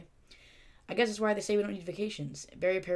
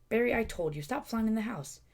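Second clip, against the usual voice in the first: a touch of a nagging mother, higher; a mom telling off her son, lightly higher; mild scolding mom; 6 semitones higher, 4 dB louder than usual. Acted.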